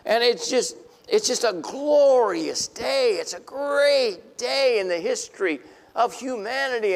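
glorious day celebration; joyful exclamation. Real, not acted. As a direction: proclaim it with wonder. Rejoicing sermon climax.

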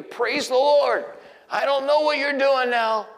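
joyful praise. Pretend. A believer praising God in trouble, joyful and high. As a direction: burst into praise. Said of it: "get to the place where I say".

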